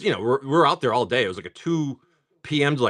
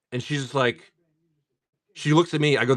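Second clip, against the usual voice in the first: usual speaking voice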